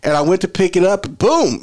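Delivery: high, lightly voiced girl; girl's story. Girl explaining the crash, lightly voiced high. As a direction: tell it as the upset girl. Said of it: "she was like".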